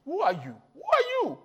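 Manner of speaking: asking who are you in a rising pitch; Who are you squeaked high the second time; mock Moses whining. Preacher voicing a doubting Moses, a rising squeak.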